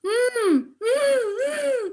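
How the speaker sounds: rising crying whimpers; high crying whimpers. Retold or imitated. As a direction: hum a climbing, tearful whimper. Crying as the driver leaves.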